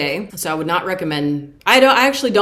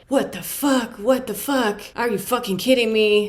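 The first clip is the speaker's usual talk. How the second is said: put-on high, pitched-up New York accent; 5 semitones higher than usual. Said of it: "In a New York accent or something".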